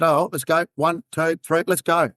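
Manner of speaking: rally him like it's a dare; urging. Retold or imitated. Cop grabs the friend's hand at the cliff, urging.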